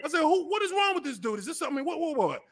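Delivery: high-pitched, hushed mock disbelief; bewildered complaint. Host replays complaining to his friend about the player, bewildered.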